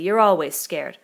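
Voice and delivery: loud, scornful interruption; contemptuous. A narrator's reading. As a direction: cut in with contempt. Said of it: "Jack broke in contemptuously".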